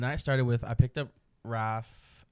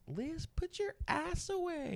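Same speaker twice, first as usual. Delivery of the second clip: much higher, slow teasing call; drawn-out high mock telling-off; mock scold; 7 dB quieter, slower than usual. Friend scolding in packed car.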